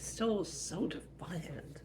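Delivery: high, drawn-out exasperation; mock-exasperated mom; exasperated mother. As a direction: sigh it out, high and slow. Mother exasperated at defiant child.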